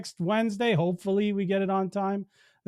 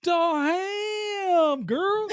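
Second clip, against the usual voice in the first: high, very slow, drawn-out put-on voice; silly ham girl voice; 12 semitones higher, much slower than usual. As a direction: stretch it out in a goofy high voice.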